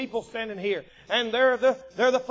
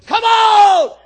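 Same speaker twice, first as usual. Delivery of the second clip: huge high shouted challenge; full-force taunting holler; Goliath; 10 semitones higher, 16 dB louder than usual. Retold or imitated. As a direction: bellow it across a battlefield. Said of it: "then Goliath would go".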